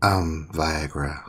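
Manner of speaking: slow low creepy caller voice; heavy breathing phone whisper. Acted. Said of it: "He's doing his stalker voice".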